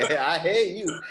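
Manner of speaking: mock jealous jab with a laugh. Real, not acted. Host laughing at the guest's youthful confidence. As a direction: tease him while laughing.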